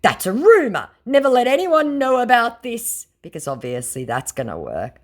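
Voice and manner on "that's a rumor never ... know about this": high, loud mocking scammer; hammed-up scammer plea. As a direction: put on a silly scammer voice. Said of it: "my scammer said".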